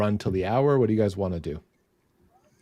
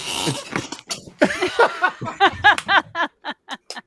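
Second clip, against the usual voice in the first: long high-pitched laughter; 4 dB louder than usual. Real, not acted. Laughing at the impression.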